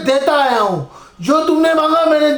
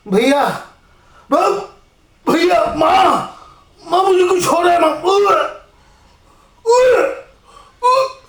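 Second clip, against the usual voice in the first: rising higher into choking gasps; poisoned panic; 4 semitones higher than usual. Acted. Poisoned son crying out to mother, choking. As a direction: call for mother as the poison takes hold.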